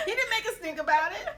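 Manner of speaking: ordinary talk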